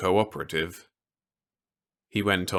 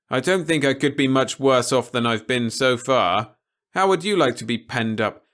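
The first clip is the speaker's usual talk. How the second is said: raised, louder, stubborn reply; defiant Peter; 6 semitones higher, 6 dB louder than usual. A narrator's reading. Peter refusing to be told what to say, lightly voiced defiance. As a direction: push back hard against orders.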